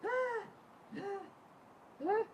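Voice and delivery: high, breathless tearless sobs; mock sob with no tears left; retold dry crying on the floor; dry sobbing. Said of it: "I start kind of just like".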